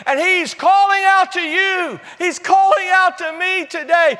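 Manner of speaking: high, loud, driving sermon delivery; ringing, emphatic proclamation; full-force preaching. Real, not acted. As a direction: build it like a fired-up preacher.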